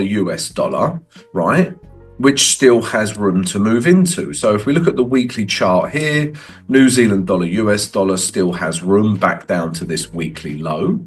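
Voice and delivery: normal talking voice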